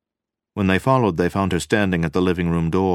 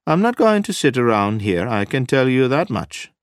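high, loud insistence; emphatic; 8 semitones higher, 6 dB louder than usual. A narrator's reading. Wife refusing to sit around at home, emphatic. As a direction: put your foot down forcefully. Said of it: "Nina said emphatically".

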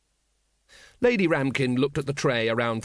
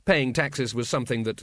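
ordinary delivery

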